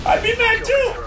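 very high, squealed jab; high-pitched taunt. Real, not acted. Mock sympathy for rival fan.

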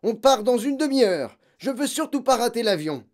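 loud, high urgent orders; father shouting angrily; impatient shouting. A narrator's reading. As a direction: yell it out, in a rush to leave. Father keeps shouting, in a hurry.

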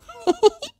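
short, very high chuckle. A narrator's reading. Once-miserable character finds laughter, short high chuckle. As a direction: let out a little acted chuckle. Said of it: "And then he chuckled".

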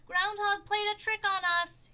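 high, very loud angry yell. A narrator's reading. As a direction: shout the news of the trick. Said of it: "he yelled".

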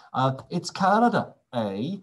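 a light mock Canadian accent with a tagged-on eh; lightly put-on Canadian accent. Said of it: "that is my one and only Canadian impression".

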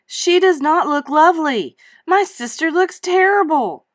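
high, loud indignant outburst; silent shout. Acted. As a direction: yell it in outrage at Robert. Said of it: "wanted to shout at Robert".

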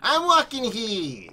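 put-on high, slow, drawn-out New York accent. Friend copying the catchphrase back, exaggerated New York accent. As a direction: stretch it like a hammed-up New Yorker. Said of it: "to do the accent".

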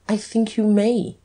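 composed, lightly needling; cool. A narrator's reading. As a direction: grant it coolly. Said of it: "said the Duke coolly".

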